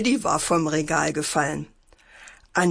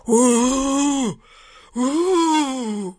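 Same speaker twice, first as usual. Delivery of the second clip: long, high "Uh" like a ghost; eerie ghost howl; 6 semitones higher, 3 dB louder than usual. A narrator's reading. Brother playing ghost to scare his sister, eerie high wail. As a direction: hoot spookily, drawing out each high wail. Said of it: "rief schauerlich".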